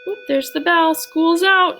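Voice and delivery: loud, high pretend announcement; mock school's-out cheer. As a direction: call out in playful excitement.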